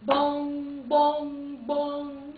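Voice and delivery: buzzer sound. Pretend. Buzzer before a guess.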